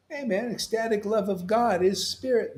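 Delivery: ordinary talk, nothing marked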